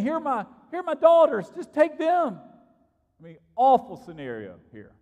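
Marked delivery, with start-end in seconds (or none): 0.0-2.4 higher and louder, rushed, holding the door shut; frantic plea. Retold or imitated. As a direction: blurt it out desperately over the noise of the mob. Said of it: "Lot is sitting there trying to hold the door shut, saying".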